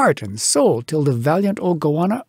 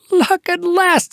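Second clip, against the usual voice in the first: much higher, slow, triumphant cry; exultant cheer; 3 dB louder, slower than usual. Acted. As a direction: crow over the find. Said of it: "a cheer of exultation burst aloud".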